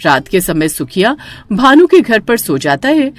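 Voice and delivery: plain reading voice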